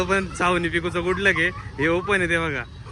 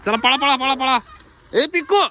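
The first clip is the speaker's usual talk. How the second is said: loud, high shout to run; calling out urgently; 10 semitones higher, 7 dB louder than usual. Real, not acted.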